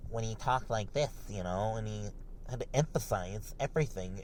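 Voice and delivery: soft, lightly lisped mock of a guru; mocking Jack Murphy voice. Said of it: "is his lispy fucking voice".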